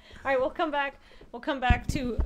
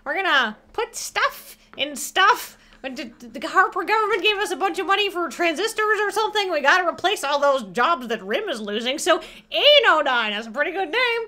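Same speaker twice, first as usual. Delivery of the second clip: hammed-up Canadian accent, higher and lively; Bob and Doug McKenzie; 5 semitones higher, 4 dB louder than usual. Co-host improvising a high-tech commercial, mock Canadian. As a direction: lay on a broad, sing-song hoser Canadian. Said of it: "I need to do a Bob and Doug McKenzie".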